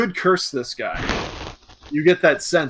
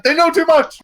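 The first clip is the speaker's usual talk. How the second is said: high, loud comic alarm; hammed-up panicked exclamation; mock panic; 8 dB louder than usual. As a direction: cry out as if found out. Joking panic of a nervous character.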